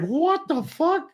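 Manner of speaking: high, drawn-out incredulous question; very high-pitched mock bafflement; baffled mock outrage. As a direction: act out stunned disbelief, stretching it slowly upward. Retold shared bafflement at presenter.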